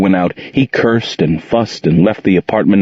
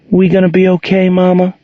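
pleading; 11 semitones higher than usual. A narrator's reading. Angel pleading, hugging mother.

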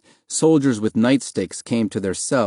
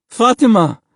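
loud, high-pitched joyful call; cry of relief; 12 semitones higher, 7 dB louder than usual. A narrator's reading. Father spotting his wife in a cell, crying with relief. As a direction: call her name in sudden relief. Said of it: "Mahmoud's father cried with relief".

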